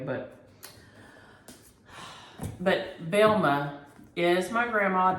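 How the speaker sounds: ordinary talk, nothing marked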